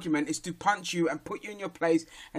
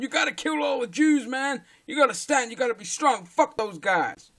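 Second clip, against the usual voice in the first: badly faked American accent, pitch up; terrible American accent; 5 semitones higher than usual. Mimicking a neo-Nazi on Vice News in an American accent. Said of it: "Sorry for my terrible accent".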